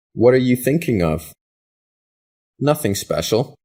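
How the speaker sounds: ordinary talk, nothing marked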